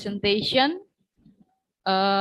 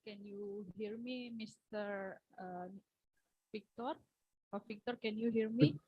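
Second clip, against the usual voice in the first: faint, far-off checking of the line; too quiet and distant from the mic; barely audible; 15 dB quieter than usual. Real, not acted. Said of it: "Could you speak louder?".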